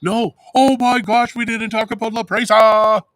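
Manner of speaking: high, loud burst of dismay; over-the-top, high-pitched exclamation; excited outburst. Real, not acted. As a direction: shriek it as if you just remembered. Sudden outburst at forgotten topic.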